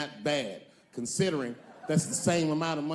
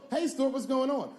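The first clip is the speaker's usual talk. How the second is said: cheery greeting; 8 semitones higher than usual. Retold or imitated. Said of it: "they act like the party's over".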